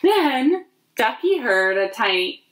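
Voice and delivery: put-on gentle storybook reading for little kids; lightly sing-song picture book narration; reading to children. A narrator's reading. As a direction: read it slowly to a group of toddlers. Reads picture book aloud.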